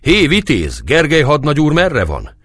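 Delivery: high-pitched call to a guard. A narrator's reading. Peasant calls up to the guard for the lieutenant, shouting. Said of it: "Az egyik fölkiáltott az őrnek".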